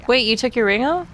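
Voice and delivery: normal talking voice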